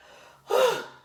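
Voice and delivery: sharp high loud gasp. Acted. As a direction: gasp as the corset tightens. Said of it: "at last she gasped".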